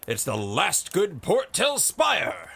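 slow, loud, high-pitched shout. A narrator's reading. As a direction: shout the toast across the pub. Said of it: "Codstone shouted".

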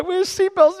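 put-on high, loud kid's protest; acted-out child yelling; panicked child. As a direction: squeal it like an insistent little boy. Acts out child insisting on seatbelts.

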